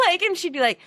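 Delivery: usual speaking voice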